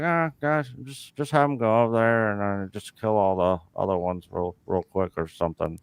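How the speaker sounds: low, soft, trailing off; offhand George impersonation. Retold or imitated. Director telling his crew to cut the fight short, offhand. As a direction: wave the job away, voice sinking. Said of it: "George was like".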